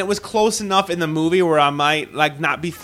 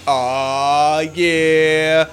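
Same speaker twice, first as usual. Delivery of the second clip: mock pleasured groan. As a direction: ham up a lazy pleasure groan. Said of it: "I'm all like".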